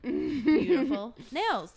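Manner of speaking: exaggerated, drawn-out imitation; mock insistence. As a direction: ham up the insistent nail tech, stretching each word. Imitating the insistent nail tech.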